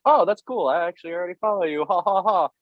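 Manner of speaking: high-pitched, affected nonchalance; airy put-on cool with a fake laugh; fake casual. Tattooer hiding worship from an idol, fake casual. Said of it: "casually like".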